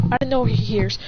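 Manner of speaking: ordinary delivery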